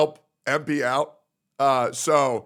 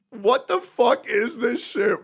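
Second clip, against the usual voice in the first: normal talking voice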